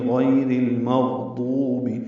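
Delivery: غیر المغضوبی with the واو held two counts; careful tajweed demo of غیر المغضوبی; slow model recitation. Shown as a demonstration. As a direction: read it slowly, holding the واو long. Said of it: "دو حرکات لمبا کریں گے".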